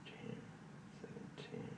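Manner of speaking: barely audible whispered counting; whispering. Real, not acted. Player reading off puzzle numbers to himself, whispering. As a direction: whisper the numbers to yourself, spaced out. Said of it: "That's why I'm whispering so much".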